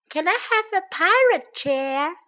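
high pleading cub voice; begging. A narrator's reading. Baby bear asking parents for a pirate chair, high begging. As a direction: beg with a rising question. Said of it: "begged Baby Bear".

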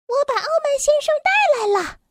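high, fast elf announcement; tiny elf voice. A narrator's reading. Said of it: "细小的声音".

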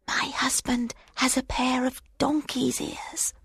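breathy secret whisper. Acted. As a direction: whisper it to the water. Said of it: "whispered the secret to the water".

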